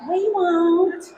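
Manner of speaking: imitating kids' drawn-out, high-pitched promise; chorus it high, like kids playing innocent; children's promise. Grandkids answering grandmother's warning about her apples, mock-innocent promise. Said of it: "And we're like".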